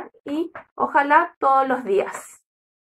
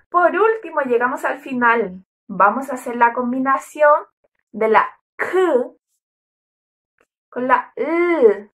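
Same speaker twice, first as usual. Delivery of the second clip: usual speaking voice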